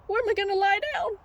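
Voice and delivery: high, fast mock-panicked sheep; freaking out. Sheep with nowhere to sleep, high hurried mock panic. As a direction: rush the question in comic alarm. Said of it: "the sheep be all freaking out".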